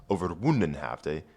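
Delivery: normal talking voice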